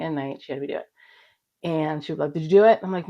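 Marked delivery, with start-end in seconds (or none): 2.3-2.8 louder, higher checking-up question; nagging mom. Retold or imitated. Mom checking the daughter did her exercise, insistent. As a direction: imitate a mother chasing you up.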